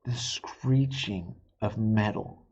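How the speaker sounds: slow hushed dread; whisper. A narrator's reading. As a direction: stretch it out in a whisper. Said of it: "whispered slow dramatic narration".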